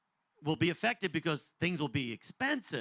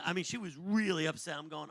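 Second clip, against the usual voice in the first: ordinary delivery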